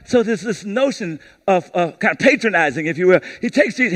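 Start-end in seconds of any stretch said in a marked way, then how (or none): none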